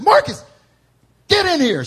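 high, loud summons of Marcus; mom yelling. Retold or imitated. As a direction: call him in like an angry mother. Mom summoning him for punishment.

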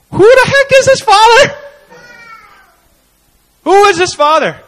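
preacher marvels out loud at the father; astonished. Real, not acted. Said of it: "astonished rhetorical question".

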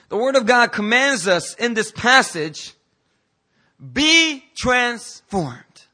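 high, loud, drawn-out commands; full-force preaching. Real, not acted. Preacher commanding the congregation to change, full-force preaching. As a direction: drive each word home slowly and forcefully.

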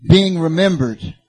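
high, loud sermon delivery; forceful preaching. Real, not acted. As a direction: drive each point home to the congregation.

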